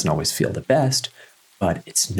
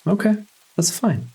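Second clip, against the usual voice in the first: higher-pitched, no-fuss reassurance; accepting self-talk; 7 semitones higher than usual. Pretend. Guide modelling what to say about restlessness, accepting self-talk. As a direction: shrug it off in a high self-talk voice. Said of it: "Just say".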